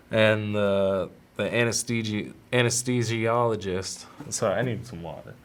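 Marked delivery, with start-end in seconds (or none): none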